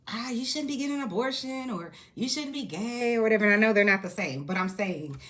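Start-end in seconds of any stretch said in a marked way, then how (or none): 0.1-3.2 higher, lightly mocking scold; mock preachy voice. Vlogger mocking people who judge others, preachy mock tone. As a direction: parody a judgmental critic. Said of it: "when people are like".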